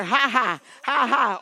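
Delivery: loud, driving ha-has; preacher pumping out laughter; deliberate laughter. Shown as a demonstration. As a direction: laugh it out forcefully on purpose. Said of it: "Laugh, Monica".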